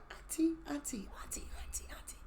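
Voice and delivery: whispered, softly calling auntie; a small voice whispering in the dark; child's whisper. Retold or imitated. A nephew's ghost whispering to his aunt, softly calling. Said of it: "I heard this little voice saying".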